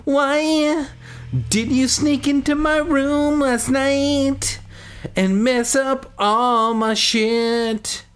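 hammed-up whine, pitched very high and held; stretched-out high mock wail; demanding. A narrator's reading. Willa accusing her sister of sneaking in, demanding. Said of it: "Willa demanded".

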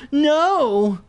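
high, loud shouted no. Acted. [name] shouts at Keith who won't let him up, loud high shout. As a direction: yell it in panic. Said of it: "I shouted".

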